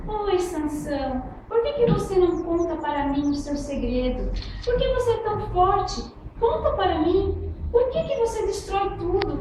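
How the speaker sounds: high, wheedling plea for the secret; coaxing Dalila. Acted. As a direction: sweet-talk him into telling. Dalila insiste com Sansão. (Dalila pedindo manhosa, voz aguda)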